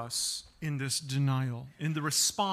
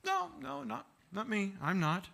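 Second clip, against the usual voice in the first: quick denial; 5 semitones higher, 4 dB quieter than usual. Retold or imitated. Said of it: "framed in a way that says".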